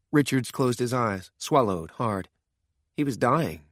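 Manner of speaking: ordinary delivery, nothing marked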